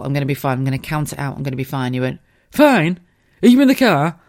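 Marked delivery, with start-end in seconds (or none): 2.5-4.2 loud incredulous mock shout; husband yelling. Husband on the phone, shocked she's driving, loud mock shout. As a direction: bark it back like the shocked husband.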